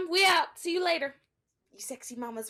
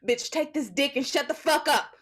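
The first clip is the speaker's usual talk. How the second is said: violent shout; 5 dB louder than usual. Acted. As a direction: bark it at her. Attacker shouting.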